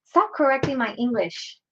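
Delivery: ordinary talk, nothing marked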